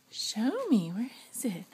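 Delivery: soft secret whisper. Real, not acted. Mother whispering to her child while searching, hushed. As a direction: breathe the words like a hiding game.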